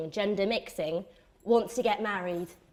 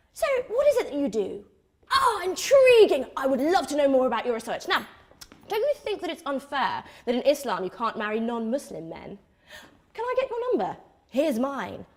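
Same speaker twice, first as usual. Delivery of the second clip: over-interested suitor; faster than usual. Acted. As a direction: mimic an eager man feigning fascination. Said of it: "So when he says".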